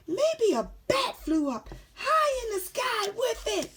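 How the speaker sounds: put-on little boy voice. Boy's imagined line.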